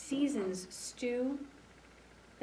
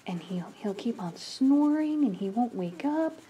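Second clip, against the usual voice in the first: ordinary delivery, nothing marked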